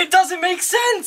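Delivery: high, drawn-out protest; exasperated, high-pitched objection. Real, not acted. Exasperated objection to plot convenience.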